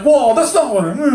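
high, loud protest; mock alarm. Complainer told to quit, mock startled protest. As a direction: back off in startled defensiveness.

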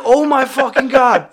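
high, exasperated outburst; retold horrified exasperation; appalled exclamation. Appalled at the grave digger.

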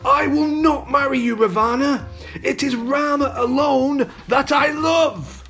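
high, impassioned refusal; defiant cry. A narrator's reading. Captive Sita refusing to marry the demon, high defiant cry. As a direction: declare it high, with feeling. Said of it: "he heard her cry".

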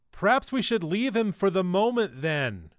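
fed-up half-shout over the sobbing; near shout in frustration. A narrator's reading. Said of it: "Rhyme nearly shouted in frustration".

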